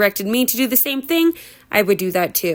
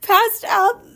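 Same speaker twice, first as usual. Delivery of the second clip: high, giggling through the words; can't stop laughing while reading; laughing fit; 10 semitones higher, slower than usual. Real, not acted. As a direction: reader cracking up mid-sentence.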